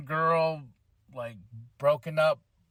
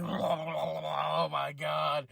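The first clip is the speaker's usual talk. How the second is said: very slow, drawn-out awe; slow stunned fan gasp; awestruck; 2 semitones lower, 3 dB quieter, much slower than usual. Real, not acted. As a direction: let it out slowly, stunned. Overwhelmed fan reaction.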